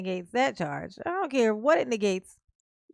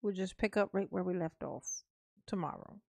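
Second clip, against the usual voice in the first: usual speaking voice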